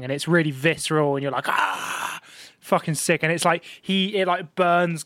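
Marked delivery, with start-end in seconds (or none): 1.5-2.2 voiceless "aah" exhaled as a stifled scream; breathy mock scream. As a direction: imitate a scream on breath alone. Imitates visceral reaction to blast.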